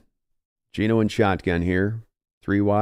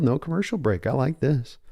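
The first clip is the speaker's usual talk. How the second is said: ordinary delivery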